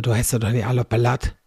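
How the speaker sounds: wie Oma auf Plattdeutsch, zügig, „auf der Latte“ als „op de Latt“; auf Platt. Retold or imitated. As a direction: Omas Spruch in plattdeutscher Färbung nachsprechen. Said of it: "aber dann auf Platt so". (auf Plattdeutsch, wie Oma es immer sagte)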